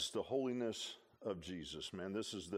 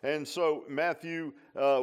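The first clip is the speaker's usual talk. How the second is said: ordinary talk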